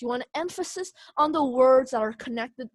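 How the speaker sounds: normal talking voice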